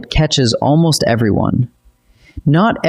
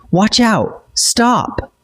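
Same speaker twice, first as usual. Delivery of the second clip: much higher pitch, no extra volume; sharp, high demo of a shouted warning; forceful urgent command; 9 semitones higher, much slower than usual. Shown as a demonstration. Teacher demonstrating urgent commands, urgent and high-pitched. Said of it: "commands shouted with real force".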